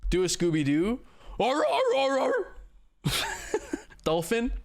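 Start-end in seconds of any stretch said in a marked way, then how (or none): 1.4-2.5 high barking arr arr; Scooby-Doo bark. Pretend. Chat asks for Scooby-Doo, high cartoon barking. 3.0-3.8 dolphin squeak. Shown as a demonstration. Asked for dolphin.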